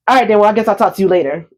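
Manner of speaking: fast, hurried goodbye; quick mock brush-off to get off the phone; rushed brush-off. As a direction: rattle it off to end the call. Husband rushing off the phone.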